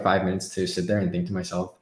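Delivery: ordinary talk, nothing marked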